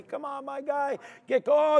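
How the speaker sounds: high, urgent pleading shout; excited shout. Real, not acted. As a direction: cry out as the price stalls. Willing the breakout, then it stalls.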